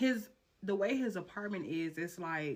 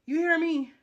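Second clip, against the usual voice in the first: ordinary talk, nothing marked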